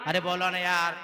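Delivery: ordinary delivery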